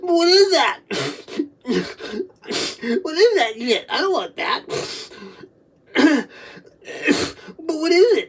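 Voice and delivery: high, loud wailing questions; real high-pitched anguished wail; overwhelmed distress. Real, not acted. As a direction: cry out between wordless bursts. Overwhelmed, reliving a horrible memory.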